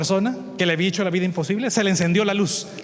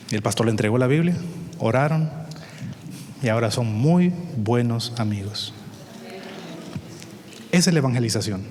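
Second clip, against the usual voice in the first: ordinary delivery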